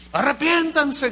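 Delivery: a mild mock cry of repent. The preacher voicing John the Baptist, crying out to repent. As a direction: call the crowd to repentance.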